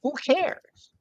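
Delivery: very high, sharp mock brush-off; mocking a CEO waving the whining away; dismissive. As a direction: cut them off with a high scoff. Dismissing the whiners.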